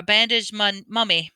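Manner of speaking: plain reading voice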